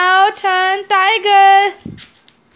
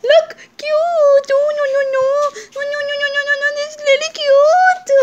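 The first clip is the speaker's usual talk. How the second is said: cooing; 7 semitones higher, faster than usual. Acted. Parent cooing over toy with child.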